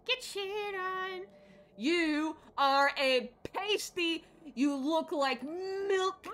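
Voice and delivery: loud, high, slow mock-angry jeer; hammed-up trash talk; drawn-out taunt. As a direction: stretch each insult out loudly. Taunting a game opponent.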